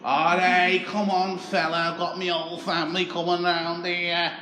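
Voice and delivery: butter him up like a chancer on the doorstep; Scouse beggar voice. Retold or imitated. Tony again.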